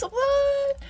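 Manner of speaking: very high, drawn-out what; hammed-up high-pitched surprise; mock amazement. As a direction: squeal it in playful disbelief. Mock amazement at her dangles.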